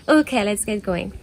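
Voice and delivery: ordinary talk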